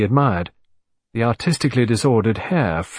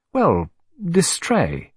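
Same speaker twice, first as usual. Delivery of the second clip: slow, searching; 6 semitones higher, much slower than usual. Acted. Linda groping for the word.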